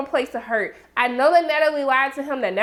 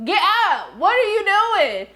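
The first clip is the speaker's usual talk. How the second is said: yelling at the TV; 7 semitones higher than usual. Retold or imitated. Warning the bear that Lottie will kill it, yelling. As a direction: shout up at the screen to save it. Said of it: "I was yelling at the T V".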